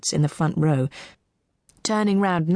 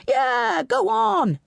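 high-pitched calls from the crowd; crowd egging on; much slower than usual. A narrator's reading. Onlookers agreeing with the posh man, egging on. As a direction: chime in high, urging him on. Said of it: "agreed a few other voices".

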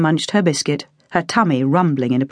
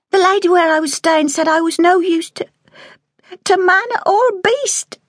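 put-on higher child voice, slowing at the end; tearful girl quoting. A narrator's reading. Girl repeating the cruel landlady's words, close to tears. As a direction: hold back tears as she repeats the insult. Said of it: "she quoted".

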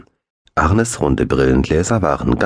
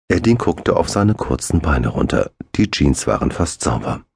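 ordinary delivery, nothing marked

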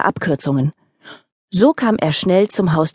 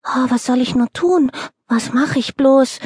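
fretting; 7 semitones higher than usual. Acted. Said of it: "jammerte der Hase".

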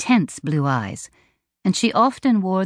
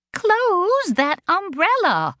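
much higher, slow drawn-out alarmed command; alarmed cry; much slower than usual. A narrator's reading. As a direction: stop her sister in panic. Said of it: "Delane cried".

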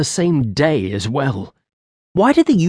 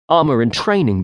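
plain reading voice

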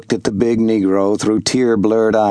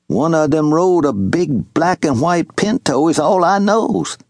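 put-on old servant, grieving; 4 semitones higher than usual. Wash telling Jubal how the raiders killed his father, lightly voiced and sorrowful. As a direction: tell the horror gently, in a lightly voiced old man's tone.

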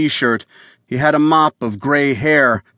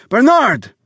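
high, loud desperate yell of a name; 12 semitones higher, 4 dB louder than usual. Acted. Wounded visitor yelling for Bernard through the door, desperate shout. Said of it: "the visitor yelled".

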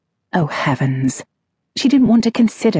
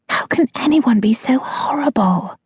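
hushed whisper, no voice; 7 semitones higher than usual. Acted. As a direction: whisper it close to an ear. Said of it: "she whispered".

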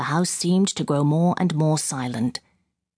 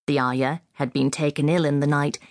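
ordinary delivery

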